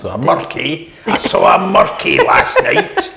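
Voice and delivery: put-on corny Scottish accent. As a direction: play a silly highland storyteller, laughing through it. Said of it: "you have to say it in a corny accent".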